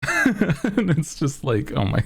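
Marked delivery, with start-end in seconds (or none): none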